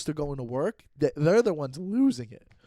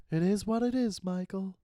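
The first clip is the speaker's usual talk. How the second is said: higher-pitched, resigned mom imitation; soft-voiced British mom; 4 semitones higher than usual. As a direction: imitate an unfazed mother, voice lifted and trailing softer. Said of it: "soft voice".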